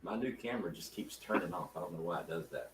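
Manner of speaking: muffled, like from another room; faint voice from behind a wall; through a wall. Real, not acted. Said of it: "Sounds like you're in a tunnel".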